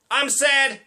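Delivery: high-pitched mock-cheery exclamation of sorrow; exclaimed sadness. Shown as a demonstration. Comic showing how not to express sadness, exclaiming. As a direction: shout the sorrow like an exclamation point. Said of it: "exclamation point".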